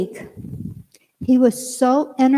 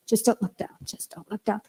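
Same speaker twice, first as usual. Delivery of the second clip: quieter and quieter, down to a near hush; scared self-talk; 9 dB quieter than usual. Retold or imitated. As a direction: talk yourself through the fear, softly.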